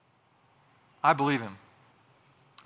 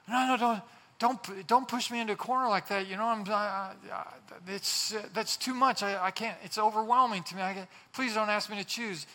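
higher, stammering protest, overwhelmed; flustered pleading; 4 semitones higher than usual. Acted. Someone begs not to be made to choose, flustered. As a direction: back away in broken, halting pleas. Acted reluctant person refusing to choose.

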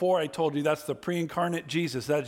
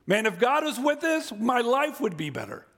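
complaining believer; 9 semitones higher than usual. Retold or imitated. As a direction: voice the everyday gripe against God, pushed up. Voices a struggling churchgoer's complaint.

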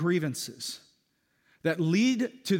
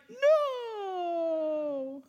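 put-on much higher little-boy voice on the edge of tears; kid voice; 13 semitones higher than usual. Pastor voicing the boy denying he'll miss mom, high child voice. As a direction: squeak it like a small child holding back a sob. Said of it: "And then he just starts bawling".

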